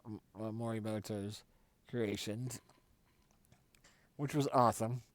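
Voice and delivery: normal talking voice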